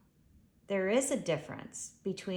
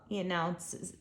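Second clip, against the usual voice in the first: plain reading voice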